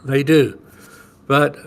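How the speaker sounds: normal talking voice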